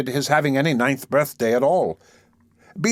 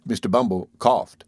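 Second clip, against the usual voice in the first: ordinary delivery, nothing marked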